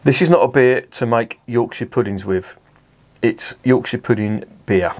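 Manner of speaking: reading the label slowly with a touch of Northern Yorkshire; hint of Northern. Reading the beer label aloud, light Northern Yorkshire accent. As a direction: lean lightly into a Yorkshire lilt while reading. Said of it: "I can't promise I won't break into Northern".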